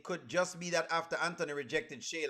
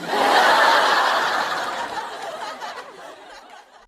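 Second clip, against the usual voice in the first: a big shrieking laugh, higher and louder; loud high burst of laughter; 12 dB louder than usual. Real, not acted. Laughing at her own idea of singing in an accent. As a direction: crack up loud.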